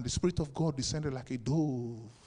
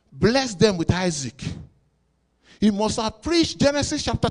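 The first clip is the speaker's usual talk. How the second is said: loud, high sermon climax; fiery preaching; 7 semitones higher, 10 dB louder than usual. Acted. Preacher thundering at his congregation, loud and high. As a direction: preach it out to the church.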